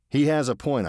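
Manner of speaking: ordinary delivery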